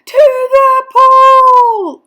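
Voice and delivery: very high, very loud drawn-out shout; boy's yell. A narrator's reading. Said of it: "I yelled".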